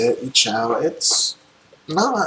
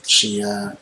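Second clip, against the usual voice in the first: ordinary delivery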